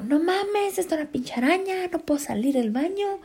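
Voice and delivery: high, panicked whimpering plea; panicked crying. Retold or imitated. Telling the cousins, crying and panicked about the spider. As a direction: sound close to tears, pitched high. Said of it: "estaba yo llorando".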